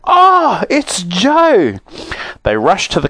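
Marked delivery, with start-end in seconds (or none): 0.0-1.8 high delighted shout of recognition; excited cry. Acted. Sisters spotting their brother Joe, overjoyed cry. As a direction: burst out with joy at the sight.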